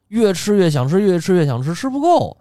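growing greed. Retold or imitated. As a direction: rise in pitch as the appetite grows.

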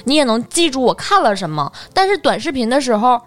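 ordinary talk

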